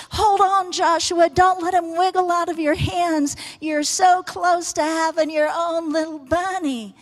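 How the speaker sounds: high, eager sideline encouragement; root for him to hold on; excited cheering. Retold or imitated. Family cheering Joshua to hold the wiggling bunny, high and eager.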